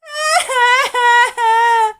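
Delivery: high, loud crying wail. A narrator's reading. Tearful sun wailing after the insult, loud cry. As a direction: let out a big sobbing wail. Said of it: "wailed the sun".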